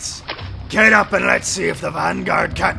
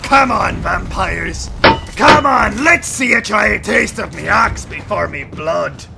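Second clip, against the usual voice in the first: defiant battle taunt. Acted. Axe fighter daring vampires to attack him, defiant shout. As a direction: goad the enemy into attacking.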